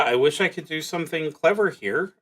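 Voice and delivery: ordinary talk, nothing marked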